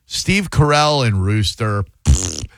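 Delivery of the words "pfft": scornful lip-flapping pfft; dismissive raspberry. Real, not acted. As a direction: blow a short, low raspberry. Scoffs at a nominated show.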